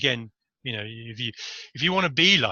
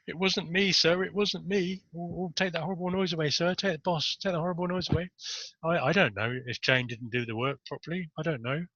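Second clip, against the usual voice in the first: high, soft whiny pleading; childish adult denial; 6 semitones higher, 3 dB quieter than usual. Retold or imitated. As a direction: act like a caught schoolboy. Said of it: "sort of going".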